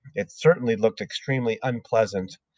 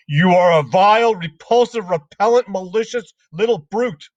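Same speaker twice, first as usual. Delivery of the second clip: much higher, louder insults; shouting; 8 semitones higher, 6 dB louder than usual. Acted. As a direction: spit each insult out. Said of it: "the Trunchbull was shouting".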